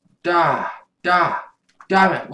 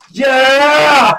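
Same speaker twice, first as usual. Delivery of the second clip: high, loud yell of victory; full-throated victory whoop; triumphant shout; 11 semitones higher, 10 dB louder than usual. Real, not acted. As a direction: belt it out like you just won. Triumphant cry after a win.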